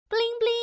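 high, twinkling chime words; sparkle sound effect. Acted. Storyteller sprinkling salt on noodles, high twinkle.

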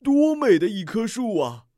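高而响的惊叹; 大声赞叹出来. A narrator's reading. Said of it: "树根惊奇地说". (树根惊奇地大声赞叹)

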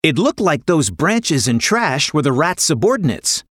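ordinary delivery, nothing marked

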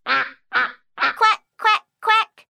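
duck quacks. Acted.

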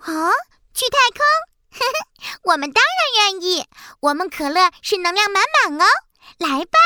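high, bouncy soda character; bubbly cola voice. Acted. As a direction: play a fizzy, eager cola drink. Talking cola voice.

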